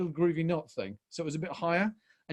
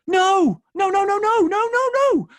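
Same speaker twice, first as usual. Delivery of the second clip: loud, high volley of refusals; father's inner protest; 10 dB louder than usual. Retold or imitated. Father seeing his daughter hurt by a sneer, shouting in protest. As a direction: reenact an alarmed dad crying out. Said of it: "something in me as a father was like".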